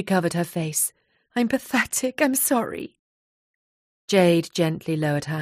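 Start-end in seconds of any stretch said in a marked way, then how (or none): 1.4-3.0 higher, understated distress; upset apology. A narrator's reading. Nia covering her face before her friend, mildly distressed. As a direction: apologise in shame, voice pitched up.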